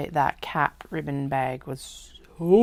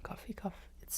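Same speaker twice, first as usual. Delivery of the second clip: whispering; 5 semitones higher, 19 dB quieter, much faster than usual. Real, not acted. Whispered aside.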